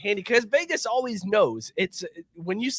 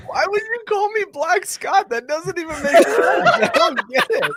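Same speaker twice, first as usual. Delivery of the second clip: high, loud, laugh-filled objection; cracking up, pitched way up; laughing protest; 13 semitones higher, 5 dB louder than usual. Real, not acted. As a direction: protest through helpless laughter. Reacting to an absurd nickname.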